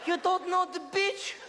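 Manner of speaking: put-on slow, high accented English, each word pushed out; father's broken English. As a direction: stretch out laboured beginner English at a high pitch.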